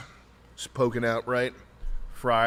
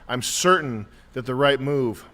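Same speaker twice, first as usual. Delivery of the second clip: ordinary talk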